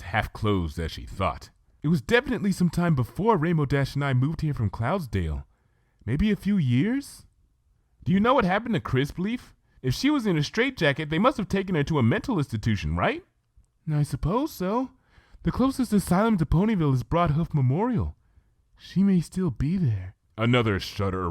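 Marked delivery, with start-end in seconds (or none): 1.8-7.3 tremble the confession out gently; timid Fluttershy. Acted. Fluttershy voiced in story. 8.0-13.2 higher, quick, eager questioning; curious Twilight. Acted. Twilight voiced in story. 13.9-20.1 tremble the confession out gently; timid Fluttershy. Acted. Fluttershy voiced in story.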